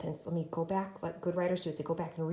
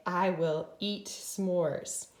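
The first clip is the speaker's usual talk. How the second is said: very slow, word by word; slow dictation; much slower than usual. Shown as a demonstration. Teacher dictating sentence slowly to class. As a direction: say each word for writing. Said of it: "I'm gonna say".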